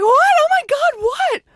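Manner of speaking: very high, shrill-free frantic mom voice; mom's panicked squeal. Retold or imitated. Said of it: "she's like".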